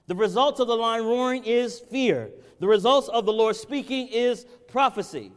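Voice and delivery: high, loud pulpit preaching; rising fervent sermon voice; preaching at full force. Acted. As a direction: preach it out, pleading with the crowd.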